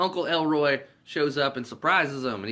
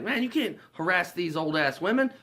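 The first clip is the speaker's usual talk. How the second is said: higher-pitched scolding imitation; mock reproach; 7 semitones higher than usual. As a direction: act out telling a friend off. Craig scolds Day Day, retold.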